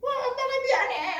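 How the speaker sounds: loud, very high crying apology; mock wailing. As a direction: wail it like a sobbing mother, hammed up. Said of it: "울면서".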